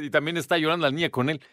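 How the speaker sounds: ordinary talk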